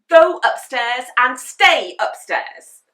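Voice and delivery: loud, slow furious order; angry shout. A narrator's reading. Mum banishing Henry upstairs, loud and slow. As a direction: shout it word by word. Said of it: "shouted Mum".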